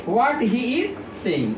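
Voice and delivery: very slow, word by word; slow dictation. Shown as a demonstration. Teacher finishing the sentence for students, very slowly. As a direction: lay each word down slowly. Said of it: "teacher dictating the answer".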